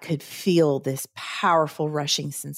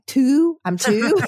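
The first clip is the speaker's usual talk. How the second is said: higher, louder joking fib; pretending to be two; 9 semitones higher, 5 dB louder, much slower than usual. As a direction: playfully claim a toddler's age, pitched up. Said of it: "wanting to lie".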